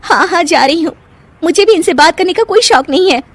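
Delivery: high-pitched, miffed retort; huffy. Acted. Wife sent to her room, huffy and high. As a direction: storm off in a huff. Bahu leaves quarrel in a huff.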